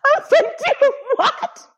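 high, cracking with laughter; can barely get it out through laughing; laughing disbelief. Real, not acted. To her scene partner, laughing in disbelief. Disbelief at friend's absurd line.

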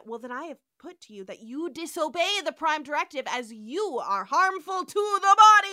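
building to a loud, high Kirk accusation; mock dramatic Kirk. Retelling Kirk cornering the computer, a hammed-up rising shout. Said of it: "Kirk's then".

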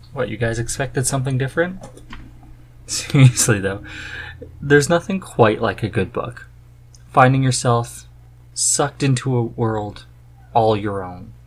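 ordinary talk

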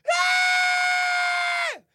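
high piercing imitated shriek; mock metal scream. Imitating the woman screaming in his ear, high shriek. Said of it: "she was literally like".

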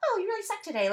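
mock yourself like a cartoon. Shown as a demonstration. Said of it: "use a cartoon voice".

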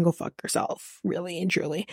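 a muttered real brush-off; whispered curse. Real, not acted. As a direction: hiss it under your breath. Cursing the ex under her breath.

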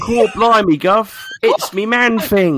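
high, loud, hammed-up Cockney; cheeky mock Cockney voice; mock Cockney accent. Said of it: "Oi, mister, you my dad" accent".

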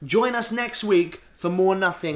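loud, high, slowed announcer delivery; hammy show sign-off. Pretend. Host signing off the show, hammed-up announcing. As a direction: stretch it out like a big outro.

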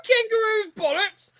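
high-pitched incredulous echo; mock outrage. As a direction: repeat it back scandalized, pitch way up. Offended echo of the insult.

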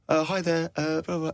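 fake kid on phone. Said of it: "not the voice of his mate".

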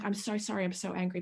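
soft, retold apology with held-in anger; hushed angry apology. Mother apologising to the doctor while angry, hushed. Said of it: "I'm trying to hushly swear".

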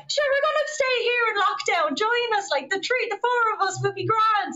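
put-on high, excited Irish girl voice from Kildare; Kildare Irish accent. Two Kildare girls spotting the couple, high excited Irish accent. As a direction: squeal it in a bubbly Kildare accent. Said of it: "hearing the accent".

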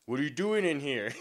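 imitating a bullying stranger; mock confrontation. Army guy confronting a young man in his neighborhood.

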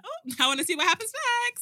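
high, soft little voice; high-pitched mock excitement; eager onlooker quote. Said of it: "And I'm like".

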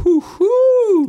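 high, loud, drawn-out sarcastic whoop; mock cheer. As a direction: cheer it ironically. Mock cheer for depression episode.

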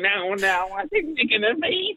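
John Richardson impression. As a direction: squeak it out like a nervous friend. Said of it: "Lovely impression there".

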